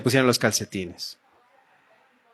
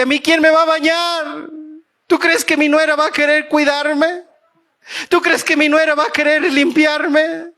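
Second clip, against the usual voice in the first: put-on worried old aunt; 11 semitones higher than usual. Old aunt fretting about who will bathe her, high and fretful. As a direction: plead like an old lady afraid of being alone.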